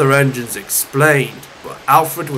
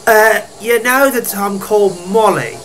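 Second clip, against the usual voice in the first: higher, hesitant engine voice; meek correction; 6 semitones higher than usual. Acted. Molly reminding her cousin of her name, meek. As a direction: correct him gently in a higher voice.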